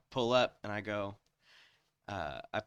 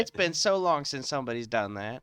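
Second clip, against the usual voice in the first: louder, higher imitation of a woman worker; sassy retold reply, pitched up; drive-thru lady; 5 semitones higher, 8 dB louder than usual. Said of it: "she said".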